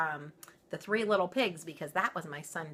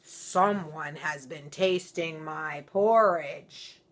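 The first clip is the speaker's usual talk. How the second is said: loud, very slow booming papa bear; great big voice; 7 dB louder, much slower than usual. Acted. Papa Bear finding his porridge tasted, slow and booming. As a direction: rumble it out slow and heavy. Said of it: "in his great big voice".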